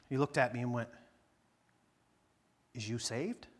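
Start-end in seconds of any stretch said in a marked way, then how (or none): 2.7-3.5 put-on local boy asking. Boy asks if saved.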